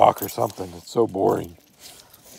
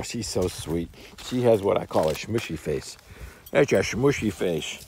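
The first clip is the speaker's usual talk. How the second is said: usual speaking voice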